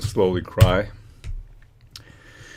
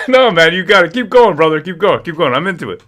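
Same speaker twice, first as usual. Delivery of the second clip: excited cheering; 8 semitones higher, 10 dB louder than usual. Real, not acted. Cheering singer on.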